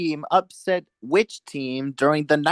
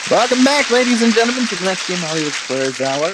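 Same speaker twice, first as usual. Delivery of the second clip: game show host; 2 semitones higher, faster than usual. Acted. Host welcomes back after break.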